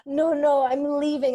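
loud, high film-heroine outburst; dramatic. Retold or imitated. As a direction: storm off like you're in a film, mock-dramatic. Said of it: "I was so dramatic".

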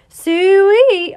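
loud high Ronaldo celebration cry; Siu yell. Real, not acted. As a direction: belt it out like a goal celebration.

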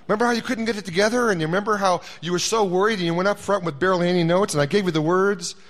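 God gently ribbing Mike about last time; God teasing. Acted. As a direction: tease him like a knowing friend.

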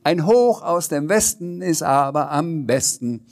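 a parting cheeky call. Acted. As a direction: shout the last rhyme in after her. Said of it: "rief hinein".

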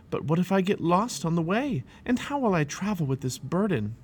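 fret, voice lifting; worried. A narrator's reading. Worrying alone about the journey.

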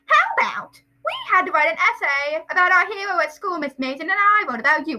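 put-on high, loud eager boy; Dudley. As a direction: pitch it like a show-off child. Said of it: "that's Dudley".

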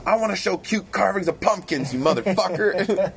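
high, squeaky arguing editors; bickering editors. Retold or imitated. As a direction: squabble over the months. Voicing bickering magazine editors.

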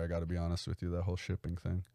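usual speaking voice

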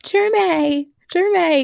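a lightly voiced tearful cry of the name; mock crying call. Fan imitating Elena crying over Jeremy. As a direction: wail his name, half in jest. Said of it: "I heard the weak in her voice".

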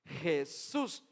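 "Jesús" with a Spanish J, "heh-SOOS"; deliberate Spanish "Jesús", stressed on the last syllable. Shown as a demonstration. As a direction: repeat "Jesús" slowly with the J as a throat H. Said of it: "la hacemos J española".

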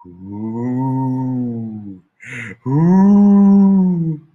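rising, ghostly long ooh howl; eerie howl. Retold or imitated. Dog howling at the moon, heard as a ghostly wail. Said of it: "it started again".

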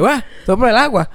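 high, loud disbelieving echo; hammed-up affronted reply; mock indignation. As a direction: fire the question back, scandalised. Acts out own indignant reply.

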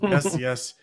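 ordinary delivery

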